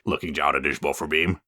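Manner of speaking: plain reading voice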